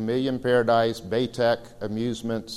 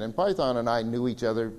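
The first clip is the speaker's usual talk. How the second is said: ordinary talk, nothing marked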